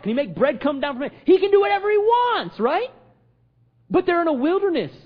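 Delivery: high, ringing sermon delivery; full-force preaching. Acted. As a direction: drive each line home to the congregation.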